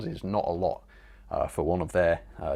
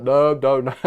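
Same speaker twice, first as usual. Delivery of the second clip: loud, higher panicked no no no; alarmed no; 4 semitones higher, 9 dB louder than usual. Retold or imitated. Streamer reliving a wrong clip playing, loud alarmed no. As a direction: blurt it out in alarm. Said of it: "I thought".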